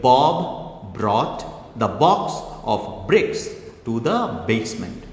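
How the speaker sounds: b sounds brought out; slow example line, B sounds stressed; alliteration demo. Shown as a demonstration. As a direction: lean on each B in Bob brought box bricks basement. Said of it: "যদি বলি".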